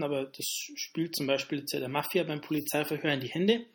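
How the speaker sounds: ordinary talk, nothing marked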